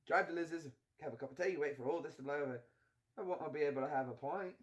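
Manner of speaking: brisk British film-hero voice; put-on British accent. Friend laying out a rescue plan, British accent.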